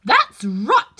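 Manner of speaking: sharp, loud royal approval; Queen shouting. Acted. The Queen shouting approval at the soldiers. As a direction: bark out the approval. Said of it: "shouted the Queen".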